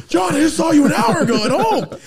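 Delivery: hammed-up kid voice; mock high kid voice. Kid shouting at uncle.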